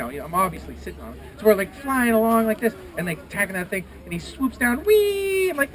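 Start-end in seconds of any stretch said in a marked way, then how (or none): 4.8-5.5 high, loud, stretched "whee"; acted joyride whoop; gleeful whee. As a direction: squeal it as you swoop down. Griffin swoops down with rider.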